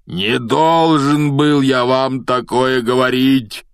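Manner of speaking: loud, drawn-out alarmed roar. A narrator's reading. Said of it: "взревел он".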